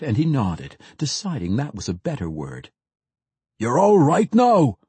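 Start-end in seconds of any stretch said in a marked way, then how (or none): 3.6-4.8 loud, slow rough dwarf reassurance; a battle-hardened dwarf calling down to the fallen mage; gruff dwarf. A narrator's reading. Dwarf tells the fallen mage he's safe, in a loud gruff voice. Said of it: "said one of the dwarves".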